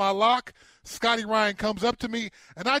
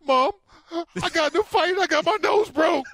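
put-on high-pitched hurt kid, half laughing; high whimpering teen; 8 semitones higher, 4 dB louder than usual. Teen calling his mom, hurt.

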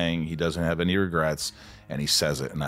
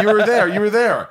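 laughing, much higher and louder, echoing his line; bursting out laughing while repeating the words; 14 semitones higher, 9 dB louder than usual. Real, not acted. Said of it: "laughing echo of the line".

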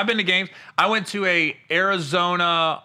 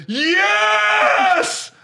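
cheering shout; 6 dB louder than usual. Retold or imitated. Retold cheer again.

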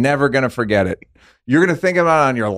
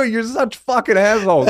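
mock insult, higher; 9 semitones higher than usual. Imitating friend's insult at party.

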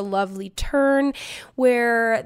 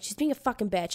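quiet griping; 2 semitones lower, 7 dB quieter, faster than usual. Retold or imitated. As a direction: grumble it privately, acted. Talking behind the friend's back.

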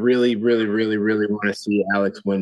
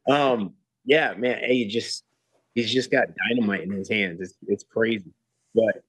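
usual speaking voice

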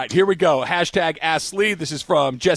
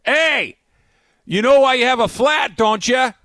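loud, high-pitched heckle; heckling Red Sox fan; 9 semitones higher, 5 dB louder, slower than usual. Retold or imitated. Rival fan taunts a man changing his tire, heckling. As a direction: imitate a fan calling out a taunt. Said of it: "some Red Sox fan goes".

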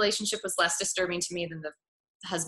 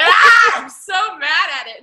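high, loud cry of fury through laughter; laughing mock outrage; 8 semitones higher, 14 dB louder, slower than usual. Reacting to the movie's sexism, loud laughing outraged cry. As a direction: wail it half-laughing in exasperation. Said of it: "I was like".

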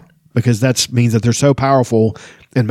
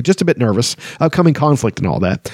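ordinary delivery

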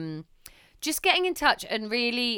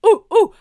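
high, loud laughing monkey hoot; 12 semitones higher, 15 dB louder than usual. Retold or imitated. Mum copying her baby's monkey impression, laughing hoots. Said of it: "did an impression of a monkey".

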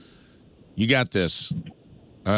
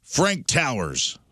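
slow announce; 2 semitones higher, much slower than usual. Acted. Host reads quiz name dramatically.

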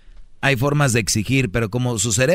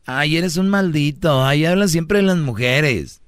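drawn-out, higher mock whine; mock complaining woman; 4 semitones higher than usual. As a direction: imitate women scolding him, slow and higher. Imitates women complaining about him. (imitando quejas de mujeres, lento y agudo)

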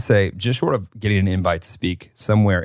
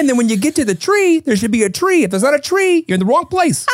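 put-on much higher, singsong Minnesotan accent, overly friendly; 3 dB louder than usual. Minnesotan local welcoming a lost tourist, high singsong accent. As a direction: gush like a Minnesotan eager to help. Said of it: "That was pretty good".

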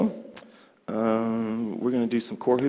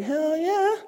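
cocky hell yeah; 3 dB louder than usual. Retold or imitated. Driving as a Brooklynite.

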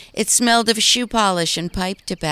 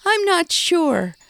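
nervous smile; 13 semitones higher, 4 dB louder, slower than usual. Acted. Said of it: "with a nervous smile".

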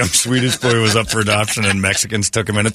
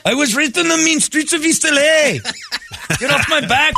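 much higher, hammed-up Swedish lilt; mock Swedish accent; 14 semitones higher than usual. Nate denying the accent to his friend, high mock Swedish lilt. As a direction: defend yourself in a silly Swedish voice. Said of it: "why do I feel like you're doing an accent".

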